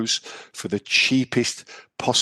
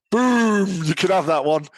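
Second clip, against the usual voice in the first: loud, high burst of glee; excited shout; 8 semitones higher, 3 dB louder, slower than usual. Real, not acted. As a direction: shout it out triumphantly. Joke punchline exclamation.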